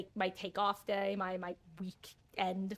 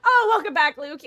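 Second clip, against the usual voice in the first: excited greeting; 8 semitones higher, 14 dB louder than usual. Real, not acted. Greeting returning teammate loudly.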